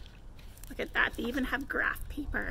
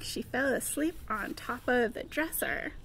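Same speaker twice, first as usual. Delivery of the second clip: plain reading voice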